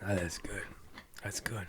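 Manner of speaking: very soft, drained voice; spent after coughing. Real, not acted. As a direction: barely voice it while recovering. Recovering from a coughing fit.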